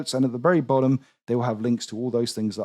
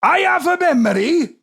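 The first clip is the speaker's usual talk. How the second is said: put-on higher, lilting Welsh accent; 6 dB louder than usual. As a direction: read it in a sing-song Welsh accent. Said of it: "I can do a respectful Welsh accent".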